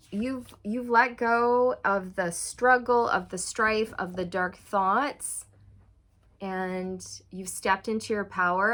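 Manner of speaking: usual speaking voice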